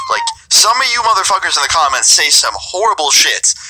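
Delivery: ordinary talk, nothing marked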